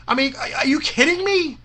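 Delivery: high-pitched, disbelieving outburst; incredulous. Real, not acted. Promoter reacting to Bill's demands, incredulous. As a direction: ask it in exasperated disbelief.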